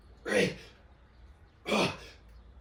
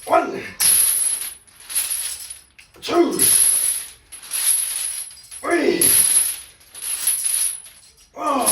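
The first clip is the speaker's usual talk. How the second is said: straining rep count; 13 semitones higher, 3 dB quieter, much slower than usual. Real, not acted. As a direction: count reps through the strain. Counting reps under strain.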